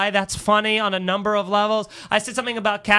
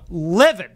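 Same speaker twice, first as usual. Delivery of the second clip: "livid" stretched out slowly; emphatic; 3 semitones lower, 5 dB louder than usual. Real, not acted. Telling co-host the women were kicked out, emphatic on "livid". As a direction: lean hard and long on "livid".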